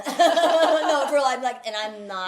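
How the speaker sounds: bursting into laughter mid-line; laughing. Real, not acted. Laughing to her friend while explaining her threats. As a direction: laugh through the words.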